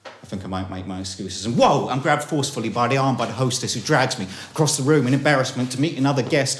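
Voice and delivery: ordinary delivery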